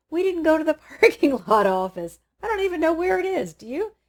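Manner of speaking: high and loud, laughing through the words; laughing realization. Retold or imitated. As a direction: giggle at your own mistake. Realizing they forgot the office.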